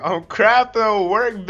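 loud high exclaim; yelling. Real, not acted. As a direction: shout it back. Yelling defensively at friend.

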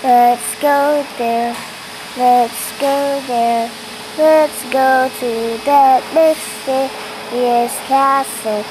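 slow, loud and grand; dramatic announcer. Acted. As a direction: announce it like an epic trailer.

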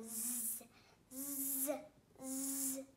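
"Z" as a buzzed "zzz", not "zee"; phonics Z sound. Shown as a demonstration. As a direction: buzz out "Z, z, z" as three held consonants.

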